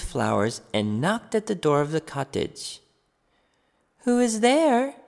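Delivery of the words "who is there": put-on a higher, louder old woman calling out; a lightly voiced grandmother calling. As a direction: call out to the door like a frail grandmother. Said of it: "called the grandmother".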